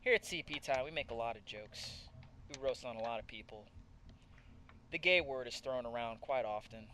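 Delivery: soft, slow fake-serious speech; mock sincere. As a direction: pretend heartfelt announcement. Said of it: "let's slow things down".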